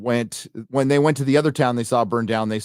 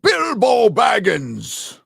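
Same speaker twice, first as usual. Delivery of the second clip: slow booming wizard shout, higher; mock wizard; 4 semitones higher, slower than usual. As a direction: parody a grand old wizard calling out. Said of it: "what does that remind you of?".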